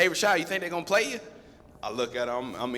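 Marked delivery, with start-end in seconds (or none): 0.0-1.2 quick, higher, eager buddy asking; eager nudge. Retold or imitated. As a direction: hurry the question, lightly excited. Buddy nudging him on sideline.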